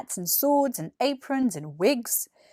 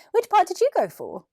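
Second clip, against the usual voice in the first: higher, curious schoolboy voice; eager boy; 10 semitones higher, 4 dB louder than usual. Acted. Billy asking James about the play, eager boy. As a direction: ask it like a keen little kid.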